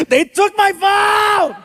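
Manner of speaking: high, slow wailing yell; imitated yell. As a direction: wail it like an outraged teenager. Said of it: "he yells at the ground".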